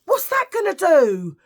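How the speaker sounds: high, drawn-out loud mocking cry; mock shout. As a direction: belt it out slowly in mock exasperation. Acting out the medics' dismissive response.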